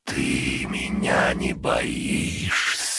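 slow, sinister lich voice; dark lord. A narrator's reading. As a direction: menace her slowly from the dark. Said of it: "зловещим голосом спросил Лич".

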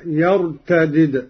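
يرتدد as "yartadid", both dals sounded; first dal of يرتدد with i, second vowelless; unmerged double dal. Shown as a demonstration. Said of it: "قرأ قالون على فك الإدغام".

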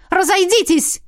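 high urgent shout; выкрик. Acted. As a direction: shout them back. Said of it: "выкрикнула девушка". (громкий выкрик)

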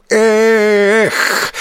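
high, drawn-out effort cry; long heave-ho "ekh"; heaving exclamation. A narrator's reading. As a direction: cry out as you hurl someone. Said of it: "с возгласом".

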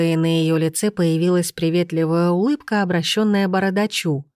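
ordinary delivery, nothing marked

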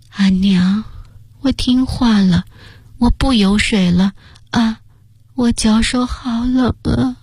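put-on slow high murmur of a dying boy; murmuring dying child. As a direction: murmur weakly like a fading child. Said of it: "喃喃着".

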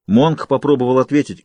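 ordinary delivery

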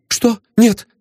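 put-on high, startled girl's answer; high startled denial; frightened young lady. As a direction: blurt it out like a scared young lady. Frightened girl answers detective.

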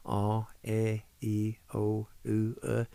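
"ah, eh, e, o, u, uh" as evenly spaced pure vowels; vowel sound demonstration. Shown as a demonstration. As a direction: recite the vowels one by one. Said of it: "remember the vowel sounds".

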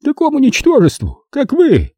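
very high, contemptuous exclamation; scornful outrage. A narrator's reading. Producer insults the man questioning him, scornful.

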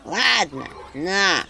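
grudging give-in from afar; grudging spirit. Acted. House spirit grudgingly giving up the egg. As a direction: give in reluctantly.

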